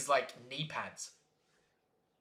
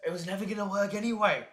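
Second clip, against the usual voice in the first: mock shrug; 4 semitones higher, faster than usual. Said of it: "you're like".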